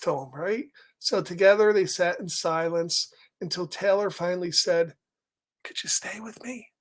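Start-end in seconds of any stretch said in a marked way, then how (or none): 5.6-6.7 small whispered plea. Acted. Taylor asking the rabbit, in a whisper. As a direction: barely voice the request.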